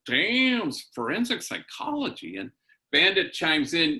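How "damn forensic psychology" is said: "Damn" jumps high, then "forensic psychology" said with admiring wonder; be floored by the answer; high, amazed "Damn", lightly retold; impressed surprise. Impressed reaction to daughter's answer.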